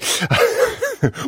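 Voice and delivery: a quick amused laugh; short laugh. Real, not acted. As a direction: laugh briefly at the pun. Presenter amused by pun.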